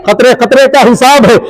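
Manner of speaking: ordinary talk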